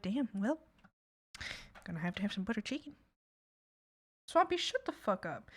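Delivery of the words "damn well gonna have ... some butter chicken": resigned mumble. Pretend. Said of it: "And you're like".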